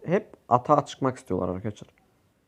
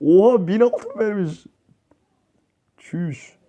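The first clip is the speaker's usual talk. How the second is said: slow, high, wondering exclamation; amazed; 4 semitones higher, much slower than usual. Real, not acted. Gamer telling viewers about a thousand gold: slow amazed exclamation. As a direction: savour the shock.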